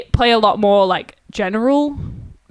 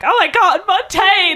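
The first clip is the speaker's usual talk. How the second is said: high, louder star-struck exclamation; excited fangirling; 9 semitones higher, 4 dB louder than usual. Retold or imitated. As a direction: re-enact a thrilled band reaction. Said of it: "That was exciting".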